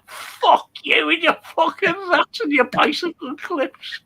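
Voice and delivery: high-pitched mock outrage; retold angry retort. Lad's last word to farmer about rats, high mock-outraged retort. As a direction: pitch it up in comic indignation.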